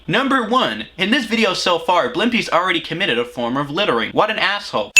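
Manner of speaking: tally up the faults in a put-on narrator voice; Cinema Sins. Host counting Blimpy's sins for the meme, narrator impression. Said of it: "should I just do a Cinema Sins impression".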